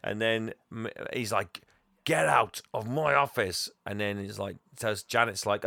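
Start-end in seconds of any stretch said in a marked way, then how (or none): 2.0-3.7 slow, higher, mock-furious order; hammed-up indignant command; angry dismissal. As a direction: act out a boss throwing someone out. Boss throwing someone out.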